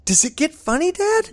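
dad mimicking his son bored by the old movie, high; imitating his bored son. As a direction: pitch it up like a bored child. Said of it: "he literally goes".

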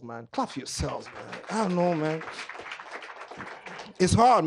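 ordinary delivery

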